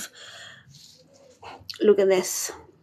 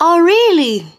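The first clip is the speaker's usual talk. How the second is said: high, loud surprised reply; delighted surprise at a comment; 8 semitones higher, 7 dB louder than usual. Real, not acted. To a viewer in chat, loud delighted surprise.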